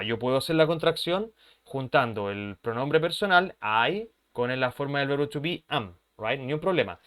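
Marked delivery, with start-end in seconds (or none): none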